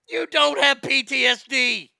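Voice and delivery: ordinary delivery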